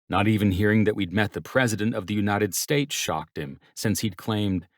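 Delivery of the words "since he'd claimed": usual speaking voice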